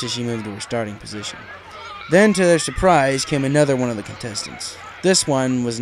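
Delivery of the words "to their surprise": usual speaking voice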